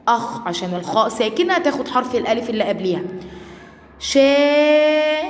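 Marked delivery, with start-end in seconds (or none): 4.0-5.2 شا held long, louder and higher; long-vowel syllable. Shown as a demonstration. Teacher isolates the madd syllable for pupils, long loud شا. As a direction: sustain "shaaa" on one high level note for شا. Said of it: "هنا مد وممدود".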